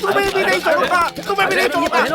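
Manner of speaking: very high, frantic ranting; hysterical rage. Acted. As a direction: rant as if driven out of your mind. Sarpanch losing his mind, storms off. (पागलों जैसी ऊँची झल्लाहट)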